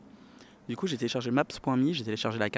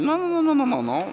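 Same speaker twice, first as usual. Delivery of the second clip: high, loud insistent no; high refusing waitress; 6 dB louder than usual. Retold or imitated. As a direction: imitate the waitress refusing, much higher. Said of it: "elle m'a dit".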